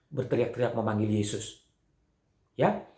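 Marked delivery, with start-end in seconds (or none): none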